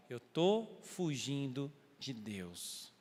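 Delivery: slow, grave admission; solemn confession. Retold or imitated. Jonah confessing to the sailors who he serves, slow and grave. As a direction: let each word weigh.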